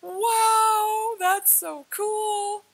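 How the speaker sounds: high, slow, stretched-out wonder; awestruck. Retold or imitated. Retelling coming back through the chair, high drawn-out awe. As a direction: draw every word out in amazement. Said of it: "and I was like".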